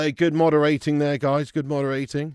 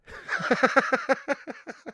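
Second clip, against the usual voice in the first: high, helpless giggle; real laughing fit; 9 semitones higher than usual. Real, not acted. Streamer breaks after his footballer joke, giggling high. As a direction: crack up at your own joke.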